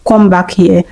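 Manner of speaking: ordinary delivery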